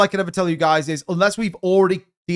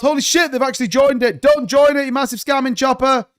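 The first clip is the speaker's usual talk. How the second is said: outraged disbelief; 7 semitones higher than usual. Real, not acted. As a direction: shout the scammer off your stream. Scammer actually joins stream.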